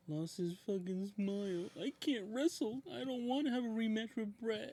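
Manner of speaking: high, dragged-out excuse voice; mock whining. Fan mocking Shawn's excuses, high whiny voice.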